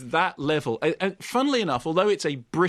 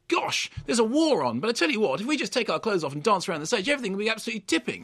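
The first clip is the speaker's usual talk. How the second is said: mock posh English type; 6 semitones higher, faster than usual. Mocking quaint English film types.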